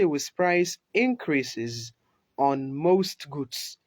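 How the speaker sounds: slow, deliberate reading; slow dictation. Shown as a demonstration. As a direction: read the example slowly. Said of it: "ku tsaya ku ji shi sosai".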